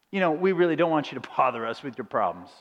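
the company's real message to customers, low and dismissive. Retold or imitated. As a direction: drop the act and brush them off. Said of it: "the message is".

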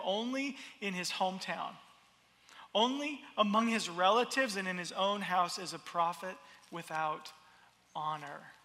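soft, quiet reading of the verse; hushed scripture reading. Acted. Preacher reading Jesus' words to the congregation, hushed and grave.

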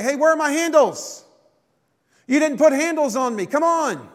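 high, whiny pot asking for its handles; complaining pot. Pretend. Preacher voicing a pot demanding its missing handles, high and whiny. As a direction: pitch it up like a pot protesting. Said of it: "the pot that the potter is making all of a sudden say".

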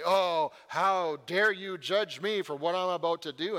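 loud, offended mock rebuke; mock God taking offense; indignant God. God scolding Abraham for haggling, a loud mock-indignant rebuke. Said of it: "God doesn't say".

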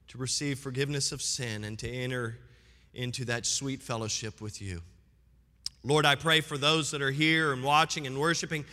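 low, hushed pastor's prayer. Acted. Pastor praying over the congregation, hushed and low.

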